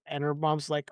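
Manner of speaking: normal talking voice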